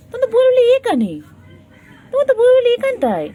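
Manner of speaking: very high, fast, loud cutesy squeal. Real, not acted. Owner teasing her cat, in a very high, fast squeal. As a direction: squeal it to a pet, high and quick.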